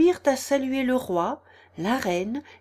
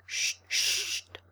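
soft, unvoiced urgent shush; low-voiced hushing; 10 semitones higher, 5 dB quieter than usual. A narrator's reading. Anxious rabbit silencing Alice, hushed. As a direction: hush quickly under your breath. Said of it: "vivement le lapin à voix basse".

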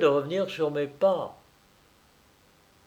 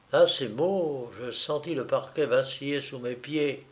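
plain reading voice

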